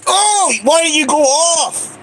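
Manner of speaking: drawn-out exasperated shout at the machine; frustrated shout. Real, not acted. Gambler shouting at the slot machine, exasperated and drawn out. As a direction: yell at the slot for not paying out.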